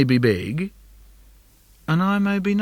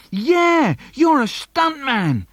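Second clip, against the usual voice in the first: put-on much higher, slower kid squeal; excited little brother; 11 semitones higher, 3 dB louder, slower than usual. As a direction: egg him on, thrilled. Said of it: "He says".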